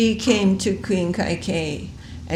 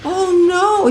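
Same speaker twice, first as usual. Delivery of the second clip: high, loud gasp of fear; terrified cry; 12 semitones higher, 8 dB louder than usual. Acted. Frightened queen hearing her son may die, crying out in fear. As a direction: cry out as dread hits. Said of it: "She became overwhelmed with fear".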